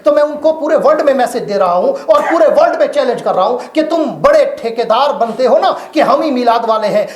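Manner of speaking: high, loud challenge to rivals; defiant preaching shout. Acted. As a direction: throw down the gauntlet. Challenging opponents. (विरोधियों को ललकारती ऊँची आवाज़)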